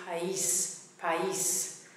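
"país" as pa-EES, stress on the i; teacher's careful "país" with a separate, accented i; two syllables. Shown as a demonstration. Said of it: "the accent there splits into two syllables".

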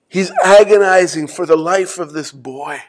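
normal talking voice

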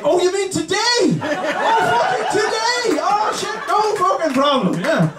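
high-pitched, exaggerated surprise; hammed-up startled agreement; mock sudden realization. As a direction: act as if jolted awake. Mock wake-up after slow talker.

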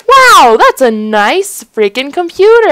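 loud, high amazed exclamation; excited outburst. Acted. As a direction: burst out high and loud, hugely impressed. Reacts to gifted new computer.

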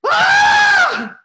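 shrill startled shriek, high and loud; mom's scream. Retold or imitated. Mom seeing the roach, a loud high shriek. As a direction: scream like you just saw a roach. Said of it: "my mom goes".

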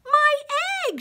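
high, loud storybook shout; elephant's startled cry. A narrator's reading. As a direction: shout in amazement. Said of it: "shouted Horton".

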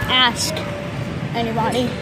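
usual speaking voice

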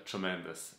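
normal talking voice

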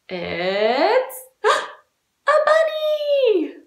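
drawn-out build, gasp, high squealed reveal; pretend surprise. Teacher revealing who's at the door, delighted mock surprise. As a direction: stretch it slowly, gasp, then go high and loud.

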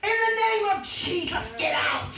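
high, forceful rebuke falling on the last words; commanding preaching. Real, not acted. Preacher ordering Satan out in Jesus' name, commanding preaching. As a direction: cast it out with authority.

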